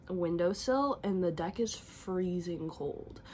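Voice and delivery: normal talking voice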